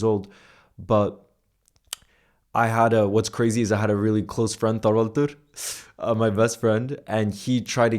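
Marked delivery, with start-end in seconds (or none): none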